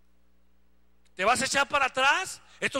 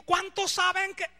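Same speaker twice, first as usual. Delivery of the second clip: imitating [name]; 6 semitones higher, slower than usual. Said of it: "Él fue el primero que dijo".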